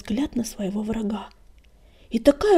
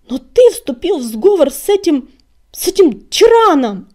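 loud, high, outraged cry; raised-voice indignation; shouted accusation; 6 semitones higher, 12 dB louder than usual. A narrator's reading. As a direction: cry out the betrayal, building at the end. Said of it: "крикнул он".

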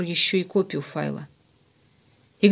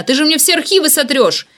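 high, loud, frantic protest; furious panic; 7 semitones higher, 5 dB louder than usual. A narrator's reading. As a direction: lash out, desperate to stop him. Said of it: "разъярённым псом кидаясь на меня". (яростно и отчаянно, на высоких тонах)

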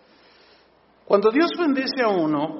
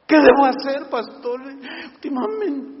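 louder, higher voice of a worried churchgoer; anxious parishioner asking for help; pleading congregant; 6 semitones higher, 5 dB louder than usual. Acted. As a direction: plead with the pastor.

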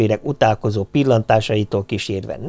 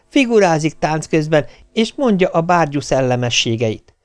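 lively, higher-pitched wife's report; exhilarated wife; 7 semitones higher than usual. A narrator's reading. Wife reports on the daughter's dancing to her husband, exhilarated. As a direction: gush about the party in a raised voice.

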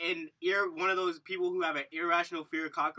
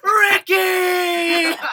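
very high, loud drawn-out cry; mock wailing shout; 13 semitones higher, 13 dB louder than usual. Joking about what he should have done, long high wail. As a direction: wail the name at full volume.

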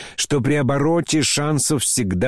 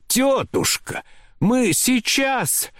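high, slow, anxious pleading; trembling, faltering plea; 13 semitones higher, much slower than usual. A narrator's reading. General trying to distract his aunt, faltering and pleading high. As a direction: stall helplessly, stretching the words out high. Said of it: "Голос у него дрожал и падал".

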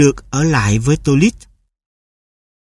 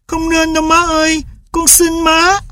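much higher, tearful plea; boy pleading in tears; 13 semitones higher than usual. A narrator's reading. Boy begs mother to keep the dog, much higher and tearful. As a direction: beg mother not to. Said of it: "Và hòa khóc".

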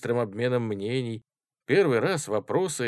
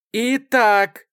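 high, very loud call; out-shout the whole room; shouting over everyone; 14 semitones higher, 11 dB louder than usual. A narrator's reading. Chairwoman reclaiming the floor, shouting. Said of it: "снова всех перекричала Варя".

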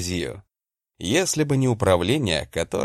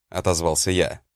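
ordinary delivery

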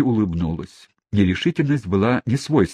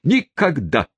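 high falling stagey refusal; theatrical tone; 8 semitones higher, slower than usual. A narrator's reading. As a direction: slam the refusal shut theatrically. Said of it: "профессиональным, то есть театральным тоном". (театрально, с падением)

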